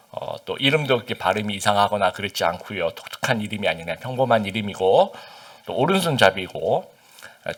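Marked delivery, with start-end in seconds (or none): none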